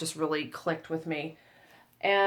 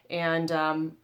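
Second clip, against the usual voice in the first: normal talking voice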